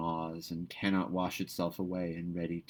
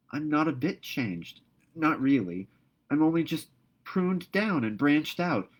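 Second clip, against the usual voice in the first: put-on higher, eager girl's voice pouring out her heart; Anne, ardent and tender; 6 semitones higher, 5 dB louder than usual. A narrator's reading. Anne comforting Marilla that she hasn't changed, ardent. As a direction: reassure her with youthful fervour.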